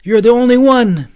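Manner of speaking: high loud pleading; frightened boy insisting; urgent boy. A narrator's reading. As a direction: plead with rising alarm.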